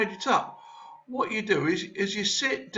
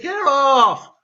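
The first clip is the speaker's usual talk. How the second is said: annoyed shout; 3 semitones higher, 10 dB louder than usual. Real, not acted. Boy shoving off a classmate.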